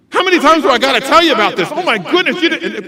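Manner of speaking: high, loud, fast rant; fly off the handle at the child; parent yelling. Shown as a demonstration. Parent to child who misbehaved, exasperated yelling. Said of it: "when you yell at them".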